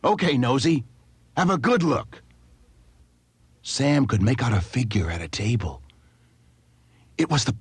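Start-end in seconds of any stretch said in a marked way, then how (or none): 0.0-2.2 shouting. A narrator's reading. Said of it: "the man was shouting".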